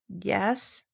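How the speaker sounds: soft, hesitant yes; a reluctant, subdued yes; quiet yes that really means no; low answer. A narrator's reading. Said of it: "was the low answer".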